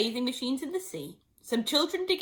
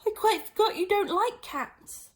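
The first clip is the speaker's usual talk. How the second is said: hasty apology; 11 semitones higher than usual. Acted. Alice hastily apologizing to the mouse. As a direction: blurt the apology out.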